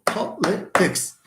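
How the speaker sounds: slow, split "Po-li-tics"; syllable by syllable. Shown as a demonstration. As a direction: break "Politics" into separate syllables for counting. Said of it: "how many syllables?".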